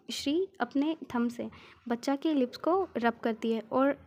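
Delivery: ordinary delivery, nothing marked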